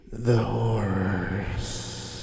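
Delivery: long drawn-out, slow horror delivery; mock-spooky. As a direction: stretch it out ominously for effect. Drags out spooky catchphrase.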